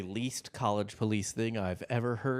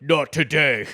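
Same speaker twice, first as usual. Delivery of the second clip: blunt, loud put-on voice; tough athlete voice; 4 semitones higher, 9 dB louder than usual. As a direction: shut him down hard. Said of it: "She's just like".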